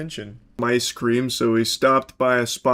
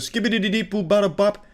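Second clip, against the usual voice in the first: quick scat gibberish, mildly voiced; mock scat; 6 semitones higher, faster than usual. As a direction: rattle off nonsense like a mad scat. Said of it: "she just did a full scat".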